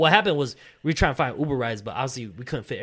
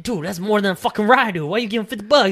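loud, higher-pitched disbelief; raised-voice incredulous protest; incredulous scolding; 9 semitones higher, 9 dB louder than usual. Retold or imitated. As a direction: act out friends telling him off. Friends' scolding, acted.

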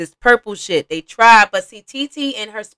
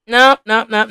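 normal talking voice

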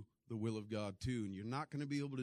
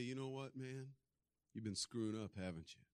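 soft confrontation; 3 semitones lower, 8 dB quieter than usual. Retold or imitated. Confronting someone gently.

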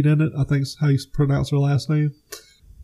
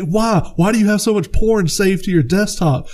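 higher, half-acted disbelief; mock grilling, raised pitch; mock incredulous Wahlberg; 7 semitones higher than usual. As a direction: needle him with a higher voice. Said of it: "he's like".

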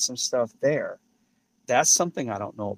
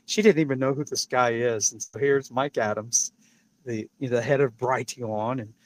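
ordinary talk, nothing marked

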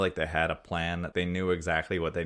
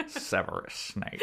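slow, soft villainous chuckle into the name; Hans Gruber; 6 dB quieter, slower than usual. Acted. Villain smirking to himself as he walks away, sly. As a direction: gloat quietly, drawing out the words. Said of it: "Hans Gruber smile as he walks away".

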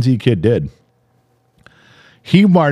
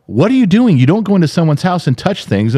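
scolding yell; 7 semitones higher than usual. Retold or imitated. Friends scolding the kid, retold.

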